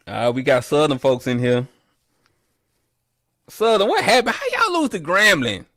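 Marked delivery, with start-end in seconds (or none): 3.5-5.6 mock disbelief. Real, not acted. Mocking Southern fans.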